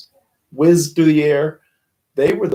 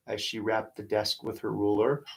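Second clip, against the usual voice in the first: ordinary delivery, nothing marked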